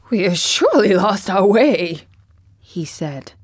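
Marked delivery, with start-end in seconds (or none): 0.1-2.0 a touch of grumble on surely. A narrator's reading. Scarecrow grumbling to Dorothy, mildly voiced. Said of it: "The Scarecrow began to grumble".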